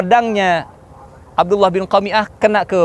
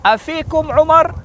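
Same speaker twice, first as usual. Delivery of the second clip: high, stretched questioning call; 12 semitones higher, 4 dB louder, slower than usual. Acted. Abu Sufyan to Muslims on the mountain, high slow call. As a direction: call slowly up to the enemy. Commander calls up to hidden enemy.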